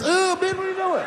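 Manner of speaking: very high alarmed shriek; shrill recoil. Retold or imitated. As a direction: shriek it, backing away. Recoiling from droplets.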